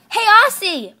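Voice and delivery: high, loud call to wake someone. Acted. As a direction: holler for a sleeping friend's attention. Calling to wake sleeping Aussie.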